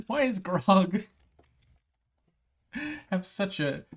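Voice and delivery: usual speaking voice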